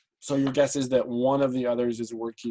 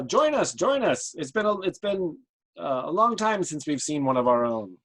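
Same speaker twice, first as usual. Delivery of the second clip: high-pitched, eager invitation; welcoming villager; 5 semitones higher than usual. Acted. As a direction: usher a long-missed kinsman in. Villager welcomes one of their kind.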